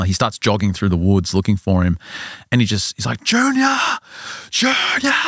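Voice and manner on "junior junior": high, drawn-out mock scream; screaming for son. Father searching the forest for his lost son, acted-out screaming. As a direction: call far into the woods, voice pitched way up. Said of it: "He's screaming at the top of his lungs".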